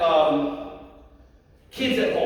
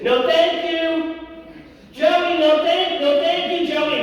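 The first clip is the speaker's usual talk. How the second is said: put-on staff correcting child; 5 semitones higher, 4 dB louder, slower than usual. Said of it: "staff that do this".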